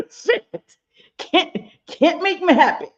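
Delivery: high squealing laugh into a complaint; exasperated laugh. Real, not acted. To viewers when the lights go too dark, laughing frustration.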